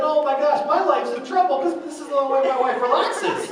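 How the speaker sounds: high, loud mock alarm; comic panic. Husband reacting to wife giving up her game, comic panic. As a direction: wail it high, in hammed-up dismay. Acts own alarm at wife's Lent.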